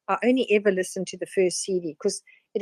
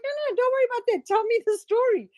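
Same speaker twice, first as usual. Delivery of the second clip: much higher, fast mock voice; imitated coach, fast; 13 semitones higher, faster than usual. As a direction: rush her past it, eager. Tony Robbins voiced in retold story.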